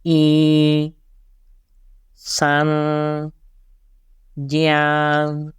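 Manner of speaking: "I. San. Jian." each held level; flat first tone. Shown as a demonstration. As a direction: keep every syllable on one flat pitch. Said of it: "From the first tone".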